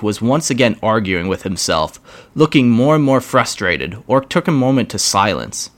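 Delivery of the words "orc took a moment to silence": ordinary delivery, nothing marked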